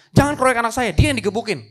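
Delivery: loud acted plea to back off; protective shout. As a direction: call out to stop a mob. President shouts to protect son.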